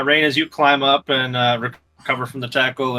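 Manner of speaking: ordinary talk, nothing marked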